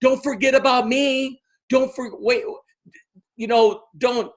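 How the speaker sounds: high, eager plea; pleading follower. Retold or imitated. People beg Tara not to forget them, high and eager. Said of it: "people are like".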